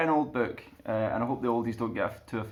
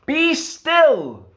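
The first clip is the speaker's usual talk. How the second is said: high, loud command; commanding Max; 8 dB louder than usual. Acted. Max taming the wild things, loud command. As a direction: order the monsters to freeze. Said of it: "Till Max said".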